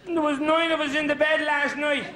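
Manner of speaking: high innocent schoolboy excuse; mock innocent boy; cheeky schoolboy. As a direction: play the earnest little pupil. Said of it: "I said".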